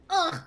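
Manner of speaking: short strangled ugh; imitated pained grunt. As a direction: grunt like you just fell. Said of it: "that was a cunty little sound you made when you fell".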